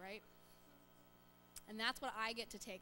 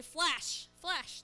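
loud flash cry; 5 semitones higher, 10 dB louder than usual. Shown as a demonstration. Leader shows kids the transfiguration flash, shouting. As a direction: shout each Flash, higher and much louder. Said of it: "we're gonna have to go like this".

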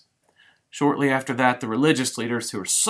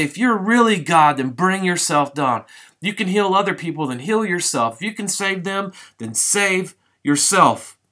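loud, high taunting crowd voice; scornful passers-by taunting the crucified man; mocking jeer; 6 semitones higher, 5 dB louder than usual. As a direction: jeer at him, sneering and loud. Crowd mocking Jesus on cross.

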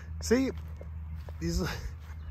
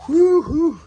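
excited whoop; 8 dB louder than usual. Real, not acted. Thrilled showing off new truck.

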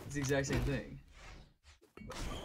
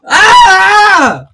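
pained yell; 8 semitones higher, 12 dB louder than usual. Real, not acted. Losing a fight.

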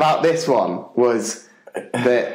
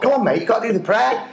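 quick, higher-pitched chivvying; hurried mock encouragement; urging him up; 8 semitones higher, faster than usual. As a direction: usher a reluctant mate along. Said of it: "I was kind of ushering him up".

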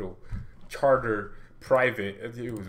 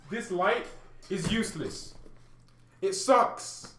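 hammed-up complaint; 7 semitones higher, slower than usual. Hammed-up plea for new lights.

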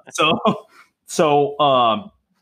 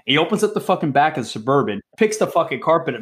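ordinary talk, nothing marked

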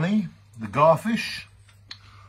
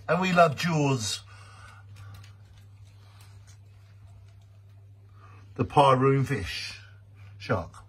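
mock solemn tribute. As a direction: recite it like a grand farewell poem. Mock tribute rhyme to Jaws.

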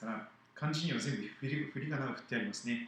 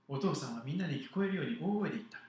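put-on animated children's story narration; lively Bible story read to children; storytelling to children; 6 semitones higher, 4 dB louder than usual. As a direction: tell it to the kids with big expressive swings.

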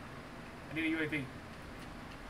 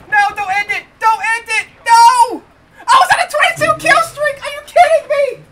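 very loud, high-pitched outraged protest; furious yelling; 9 semitones higher, 13 dB louder than usual. Real, not acted. Player loses a twenty-two kill streak, furious yelling. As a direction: scream in real outrage at the game. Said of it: "I'm so mad right now".

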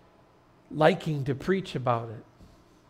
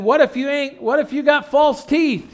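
high, loud heckler's question; heckling country farmer; 12 semitones higher, 9 dB louder than usual. Retold or imitated. Farmer having fun with the pastor, calling out teasingly. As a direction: call it out to needle the preacher. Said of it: "decided to have a little fun with the pastor".

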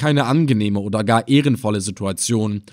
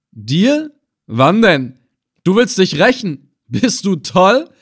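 high, raised, disbelieving questions; incredulous scoffing; 8 semitones higher, 5 dB louder, slower than usual. A narrator's reading. Captor hears bound prisoner promise revenge, incredulous scoffing. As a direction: scoff at the empty threat.

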